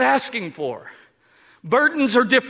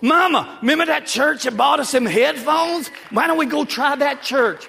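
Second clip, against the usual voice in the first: put-on higher, louder child calling to mama; little boy calling; 6 semitones higher, 5 dB louder than usual. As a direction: call out like an excited little boy. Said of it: "the little boy's gonna go".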